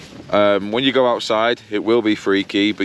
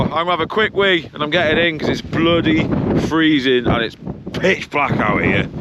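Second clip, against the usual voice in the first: ordinary delivery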